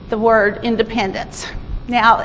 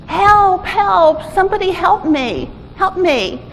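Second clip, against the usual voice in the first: mild mock yell, higher pitched; calling for help; 6 semitones higher, slower than usual. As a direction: call up from a manhole, pitch falling off. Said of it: "Is someone yelling".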